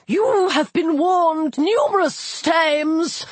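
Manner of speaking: high, slow hissing air god. A narrator's reading. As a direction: hiss like air from a balloon. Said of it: "His voice hissed like air".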